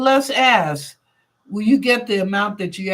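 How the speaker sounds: ordinary talk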